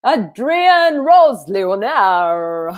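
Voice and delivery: the guest's name said slowly with a French accent. Shown as a demonstration. Introducing the guest by name in a French accent. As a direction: stretch each part of the name the French way. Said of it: "I say it with my French accent".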